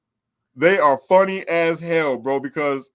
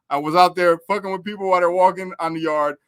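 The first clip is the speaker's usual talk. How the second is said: ordinary talk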